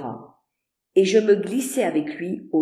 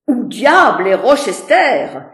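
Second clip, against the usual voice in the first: loud, demanding shout; exclaiming; 4 semitones higher, 9 dB louder than usual. A narrator's reading. Colonel demanding where Rochester is, loud exclamation. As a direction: bellow out for Rochester. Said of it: "s'écria le colonel Dante".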